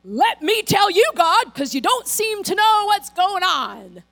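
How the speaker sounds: Elijah's pity party. Retold or imitated. Elijah ranting to God in a loud, high self-pitying whine. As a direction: complain like a self-pitying prophet. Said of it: "He's like".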